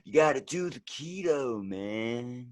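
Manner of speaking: slow, drawn-out West Coast bro; West Coast accent. Acted. Bro preaching keto and vegan lifestyle, West Coast accent. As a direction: stretch it like a laid-back West Coast dude. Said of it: "your West Coast for sure".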